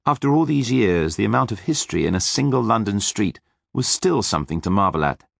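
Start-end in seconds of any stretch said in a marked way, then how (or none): none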